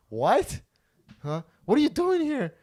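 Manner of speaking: high, loud retold outburst; shocked confrontation. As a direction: act out her shocked yelling. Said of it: "the girl goes".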